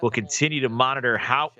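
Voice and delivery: mock golf broadcast play-by-play; hammed-up golf commentary; sports commentator. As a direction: call the shot like a TV golf announcer. Streamer does mock golf commentary.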